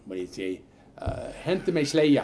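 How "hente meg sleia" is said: retold holler from dad, half-voiced; mock farm holler. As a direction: call it out across a field, a bit louder. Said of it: "communicated by hollering".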